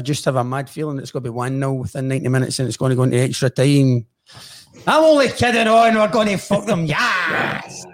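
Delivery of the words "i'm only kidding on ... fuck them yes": higher, louder burst of bravado; excited cheer. Real, not acted. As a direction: cheer your team on, ending on a drawn-out yes. Joking bravado about match prediction.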